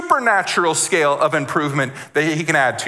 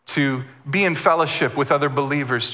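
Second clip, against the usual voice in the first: ordinary delivery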